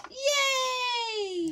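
high happy cheer; excited cheer. Real, not acted. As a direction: cheer him on, high and bright. Cheering toddler's success.

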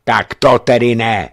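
sudden loud denial; blurting denial. A narrator's reading. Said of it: "vyrazil Lockhart".

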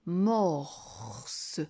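"Morse" slowly, with long R and separate "se"; "Morse" drawn out sound by sound. Shown as a demonstration. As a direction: stretch "Morse" into mo-rrr-se. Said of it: "la première syllabe a trois sons".

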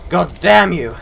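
loud, high angry curse; real gamer rage; furious shout. Real, not acted. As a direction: curse the marine out. Killed by enemy marine.

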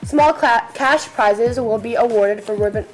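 ordinary talk

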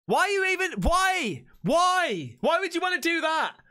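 high, incredulous pleading; exasperated. Real, not acted. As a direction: demand an explanation in disbelief. Exasperated at man summoning devil.

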